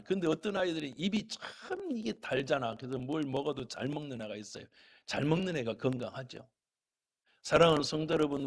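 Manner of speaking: ordinary talk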